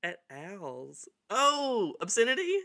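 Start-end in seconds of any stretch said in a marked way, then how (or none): 1.3-2.6 excited realization. Real, not acted. Suddenly remembering the name.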